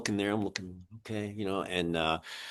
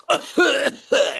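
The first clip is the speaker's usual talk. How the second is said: high cackling laugh; 5 dB louder than usual. Retold or imitated. Laugh after the quote.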